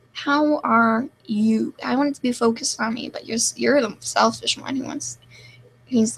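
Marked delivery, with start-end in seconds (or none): 0.1-1.7 slow, drawn-out question; measured, over-deliberate show of asking; exaggerated asking. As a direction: draw each word out deliberately. Repeats the mock-earnest question.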